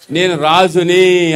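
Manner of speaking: very slow నేను రాజుని with weight on రాజుని; slow declaration. Shown as a demonstration. Preacher modelling a royal confession for the crowd, very slow. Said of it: "అనండి".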